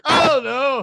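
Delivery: loud high panicked cry; panicked outburst. Retold or imitated. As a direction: blurt it out in sudden panic. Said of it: "freaking out and shit".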